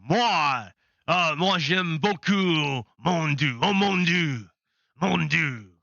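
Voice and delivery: slow, drawn-out mock French accent. French person answering who they worship, hammed-up French accent. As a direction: play a French person, lingering on the vowels. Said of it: "And the French person".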